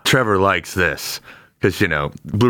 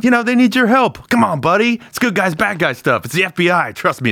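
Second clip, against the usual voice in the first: pleading pitch; 9 semitones higher than usual. Acted. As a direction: sell it to a reluctant buddy. [name] begs his buddy.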